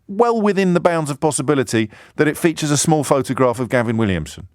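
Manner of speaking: normal talking voice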